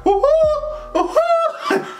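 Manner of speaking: very high laughing whoops; mock cheering.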